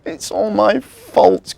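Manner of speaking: high, loud, slow wail; tearful cry. Acted. Elf Marzi confesses to Timmy's mummy, slow tearful cry. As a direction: wail it out in guilt. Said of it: "cried Marzi".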